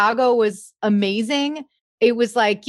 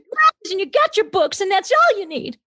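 high, insistent, hammed-up character voice; mock interrogation in a shrieky film-star impression; put-on movie character; 11 semitones higher than usual. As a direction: grill him in a theatrical high-pitched voice. Puts on movie voice, teasing Tom.

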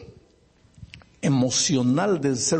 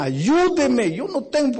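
1 tearful plea; 11 semitones higher, 3 dB louder than usual. Acted. Driver begging in tears.

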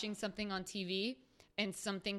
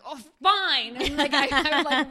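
annoyed; 6 semitones higher, 15 dB louder than usual. Retold or imitated. Annoyed brother quote, loud.

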